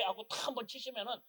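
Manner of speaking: ordinary talk, nothing marked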